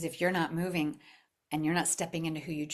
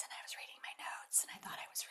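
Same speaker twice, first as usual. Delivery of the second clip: whispered, much softer, meek reading voice; timid whisper; 13 dB quieter, faster than usual. Shown as a demonstration. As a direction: whisper it like you're hiding behind notes. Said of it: "I was reading like this".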